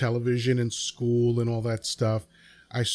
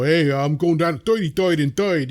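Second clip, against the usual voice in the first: thick put-on New York accent, higher and louder; 5 semitones higher, 6 dB louder than usual. Guest mocks the typical New Yorker, thick New York accent. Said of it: "I don't have that kind of New York accent".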